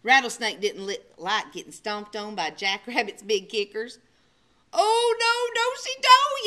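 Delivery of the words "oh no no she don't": high, loud panicky yelp. Acted. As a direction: yelp while dodging. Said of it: "yelped Jackrabbit".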